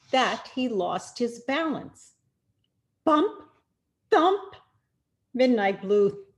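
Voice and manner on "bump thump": bump and thump called out higher and louder; lively sound words. A narrator's reading. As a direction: let each sound word pop out like a crash. Fall off the bike, sound words.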